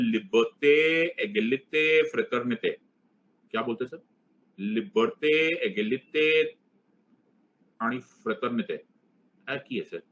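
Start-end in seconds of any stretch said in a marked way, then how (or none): 0.0-2.7 put-on French pronunciation with stretched "ay" endings; mock French accent. Shown as a demonstration. Teacher showing off French to students, mock French accent. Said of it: "ऐसे बोलते हैं French". 4.6-8.8 put-on French pronunciation with stretched "ay" endings; mock French accent. Shown as a demonstration. Teacher showing off French to students, mock French accent. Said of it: "sir, ऐसे French मत बोलो".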